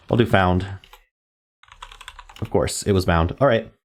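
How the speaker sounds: ordinary talk, nothing marked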